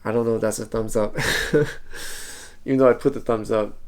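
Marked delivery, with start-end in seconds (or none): 1.2-2.6 awkward laugh. Real, not acted. Awkward moment about a thumbs up.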